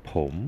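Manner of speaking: ผม with the pitch rising at the end; teacher demo of ผม, rising. Shown as a demonstration. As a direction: lift ผม upward. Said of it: "with the rising tone".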